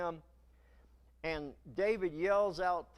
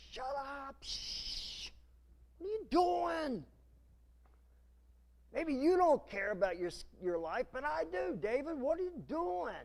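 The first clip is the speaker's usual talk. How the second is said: high frantic hiss then shout; a scared man trying to shut David up; panicked hush; 7 semitones higher than usual. Acted. One of David's men to David: panicked high hush. Frightened follower hushing David.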